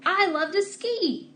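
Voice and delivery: high, slow and excited; excited exclamation. Shown as a demonstration. Teacher showing an exclamation with excitement. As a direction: gush about skiing. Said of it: "Here's an exclamation".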